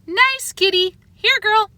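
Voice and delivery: high sweet cat-calling; old lady luring cat with bacon; coaxing. A narrator's reading. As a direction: coo at the kitty.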